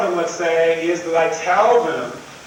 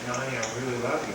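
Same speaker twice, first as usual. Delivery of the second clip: imitating the husband, very soft and low; a hushed, loving "honey"; tender love tone; 8 semitones lower, 11 dB quieter, much faster than usual. Husband finally tells his wife he loves her, soft and tender. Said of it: "he just says it in that tone".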